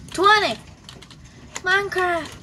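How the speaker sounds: excited shout. Real, not acted. Triumphant count finish.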